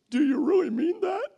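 preacher voicing his wife's hurt question, high; mock hurt wife. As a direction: play the hurt wife asking.

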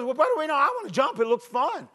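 put-on high-pitched eager kid with W for R; squeaky Tommy. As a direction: pipe it up like a little boy. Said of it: "he had a high squeaky voice".

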